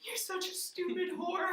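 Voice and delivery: high, slow worn-out scolding; retold exhausted insult. Sister on floor after the scare, slow spent insult. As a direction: draw it out slowly, high and spent.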